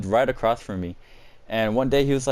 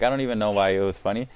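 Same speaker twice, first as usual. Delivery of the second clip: ordinary talk, nothing marked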